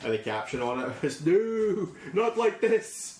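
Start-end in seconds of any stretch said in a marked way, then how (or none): 1.2-3.2 high, loud, slow wail; high drawn-out mock anguish; mock despair. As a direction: cry it out like a tragic hero. Mickie's caption voiced.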